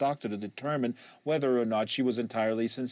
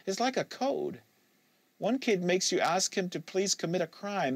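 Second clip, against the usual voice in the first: put-on higher, livelier young woman explaining the code; Kate, animated; 7 semitones higher than usual. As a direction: Kate warming up as she explains the dealers.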